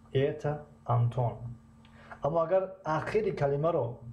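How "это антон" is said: measured statement, "Антон" dropping at the end; statement intonation. Shown as a demonstration. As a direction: let "Антон" fall, as a statement. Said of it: "Ҷумлаи хабарӣ".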